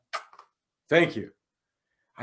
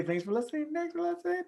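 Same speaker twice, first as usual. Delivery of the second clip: put-on high, childlike thanks for listening; little kid voice; 4 dB quieter than usual. Imitating his young daughter Vi signing off, high and childlike. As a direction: chirp it like his small daughter. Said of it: "I think Vi's gonna say".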